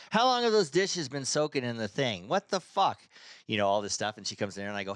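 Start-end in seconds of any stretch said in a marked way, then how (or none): 0.1-3.0 shrill-pitched nagging wife, pitched up high; a scolding wife, voiced up high; mock nagging wife. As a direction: rant at him like a fed-up wife. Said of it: "she goes".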